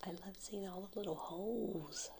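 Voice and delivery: soft ASMR whisper over tapping; whisper with nail taps. Real, not acted. ASMR whisper showing coral shells.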